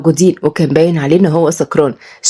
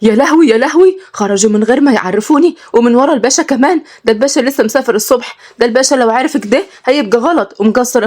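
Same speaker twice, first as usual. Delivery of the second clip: higher, panicked wailing; a housekeeper panicking over the missing boys; shocked panic; 6 semitones higher than usual. A narrator's reading. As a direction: wring your hands and wail in alarm. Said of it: "سلمى اتصدمت".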